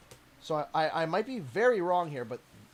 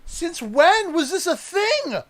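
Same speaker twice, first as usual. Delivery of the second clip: surprised; 9 semitones higher, 4 dB louder, slower than usual. Real, not acted. Surprise Pokemon statue in unboxing.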